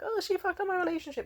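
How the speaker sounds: high, mocking whiny voice of the ex; high sarcastic imitation of a guy blaming her; mocking his whine. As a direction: sneer his complaint back in a high pitch.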